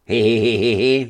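high, loud tittering laugh; giggling hee hee. A narrator's reading. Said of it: "zasmál se též Fjodor". (hlasité vysoké chichotání)

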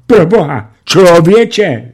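high, loud exclamation; horrified cry. A narrator's reading. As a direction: panic at the guns. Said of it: "vykřikl tlustý náčelník". (zděšeně vykřiknout)

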